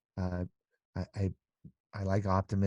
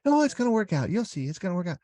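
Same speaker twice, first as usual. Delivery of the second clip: very high, insistent mock optimism; fake reassurance; 11 semitones higher, 5 dB louder, faster than usual. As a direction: parody a relentlessly upbeat friend. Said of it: "I don't like the fakeness of that".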